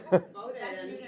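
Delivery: short soft chuckle; laugh. Real, not acted. Rabbi amused at his old Thanksgiving class, soft laugh. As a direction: chuckle softly to yourself.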